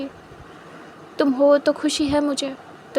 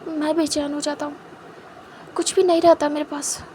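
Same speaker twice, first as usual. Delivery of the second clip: softer, half-whispered, halting; soft tender confession; 6 dB quieter than usual. Acted. Wang Ji hugging Wing, confessing how lost he is without him, softer and half-whispered. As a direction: pour out longing almost in a whisper.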